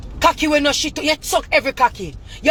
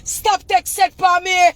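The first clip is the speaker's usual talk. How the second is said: crying shout; 7 semitones higher than usual. Real, not acted. Pleading rant at rival's mother.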